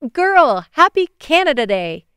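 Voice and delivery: put-on high, loud, gleeful girl; excited greeting. A narrator's reading. Amaya greeting her friend Bailey on a video call, excited. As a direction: burst out with a thrilled greeting. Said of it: "her eyes sparkling with excitement".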